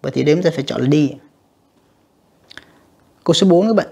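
ordinary delivery

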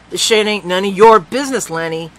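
loud, cold retort; angry retort. Acted. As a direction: shut him down hard.

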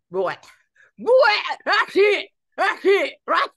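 loud, high, lipless angry bursts; mock fury with the lips gone; getting the hump. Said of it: "when I get the hump, I can't believe how my mouth goes".

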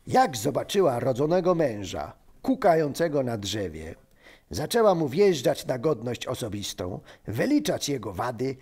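ordinary talk, nothing marked

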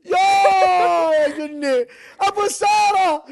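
high, slow, wailing cries of excitement; high drawn-out wailing. Real, not acted. Wild excited wailing at game.

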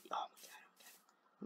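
barely audible, voiceless whisper; frightened whisper. Real, not acted. Player after a close call with a monster, frightened whisper. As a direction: whisper it under your breath.

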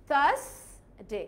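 slow British "thuhs... day", silent R; British accent. Shown as a demonstration. Teacher proving the R is silent, slow British accent. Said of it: "আমি কি আরটাকে উচ্চারণ করেছি?".

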